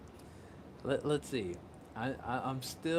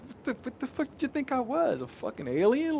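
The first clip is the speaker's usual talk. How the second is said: high, louder laughing disbelief; incredulous; 12 semitones higher, 3 dB louder than usual. Retold or imitated. Reacting to people treating him as a star, incredulous. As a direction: protest high, in amused outrage. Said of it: "And I'm like".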